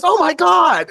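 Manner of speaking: high, loud exclamation of delight; re-enacted overjoyed shock; amazed recognition. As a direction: burst out as it all comes back. Imitates Dave recognizing him with hug.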